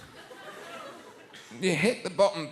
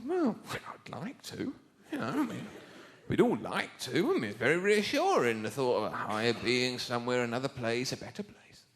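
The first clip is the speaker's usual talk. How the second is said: soft musing; 5 semitones lower, 6 dB quieter than usual. Retold or imitated. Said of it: "And I said".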